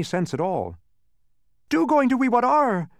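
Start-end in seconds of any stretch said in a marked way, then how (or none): 1.7-2.9 high-pitched, loud wail of complaint. A narrator's reading. Second salesman fretting over the mess, loud complaint. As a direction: complain loudly at the top of your range. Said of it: "complained another".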